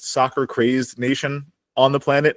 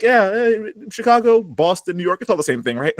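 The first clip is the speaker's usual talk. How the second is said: higher-pitched careless shrug of a voice; mock dismissive; 8 semitones higher than usual. Host mocks show makers who lump cities together, mock dismissive. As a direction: brush it off, imitating someone who can't tell the difference.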